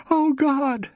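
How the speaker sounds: high, loud scream of horror; a shrieking cry to God. A narrator's reading. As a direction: scream it at the ceiling. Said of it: "screamed to the ceiling".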